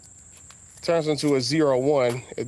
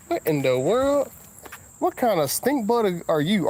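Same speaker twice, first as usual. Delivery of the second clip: higher, startled question at a bug; 8 semitones higher than usual. Real, not acted. Driver spots a stink bug on his car, startled.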